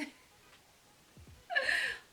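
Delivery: high, loud real laugh breaking out; laughing hard at the memory; burst of laughter. Real, not acted. Laughing at how they felt as failures, loud real laugh.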